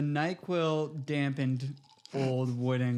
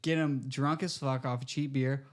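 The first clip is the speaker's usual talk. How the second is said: normal talking voice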